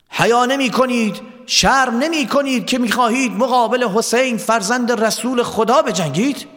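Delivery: high, declamatory reproach; indignant rebuke. Acted. Hor rebukes the army facing Hussein, indignant and defiant. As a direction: shame them, pitch held high.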